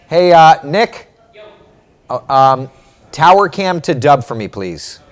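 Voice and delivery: loud, high call to a crew member. Real, not acted. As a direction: holler a request across the studio. Calls to crew member Nick.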